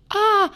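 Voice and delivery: short high yelp; a held-back little cry; restrained yelp. Acted. Laen hanging on her neck yelps high. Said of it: "довольно сдержанно покрикивал Лаен".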